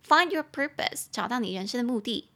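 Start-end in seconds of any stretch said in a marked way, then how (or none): 0.1-1.1 "Find" leaned on, a bit higher, slow; slow teacher model, stress on "Find"; slow model phrase. Shown as a demonstration. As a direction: lift "Find" and let it fall. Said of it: "Find your purpose and your people".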